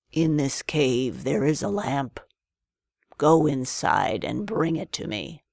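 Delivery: a hint of a sly old man giving orders; old man sending Aladdin into the cave. A narrator's reading. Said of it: "said the old man".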